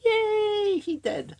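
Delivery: very high squealed cow cheer; mock cheer. Cows cheer in retold story.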